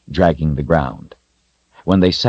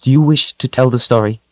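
higher, cool reproving question; stern rebuke; 8 semitones higher than usual. A narrator's reading. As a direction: put him in his place. Kalen rebukes the wolf.

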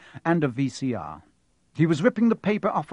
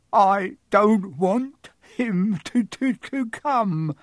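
much higher, louder, slow whiny pretend sob; fake sobbing yell; 7 semitones higher, 5 dB louder, much slower than usual. A narrator's reading. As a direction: wail it like a spoiled brat faking tears. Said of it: "yelled between huge pretend sobs".

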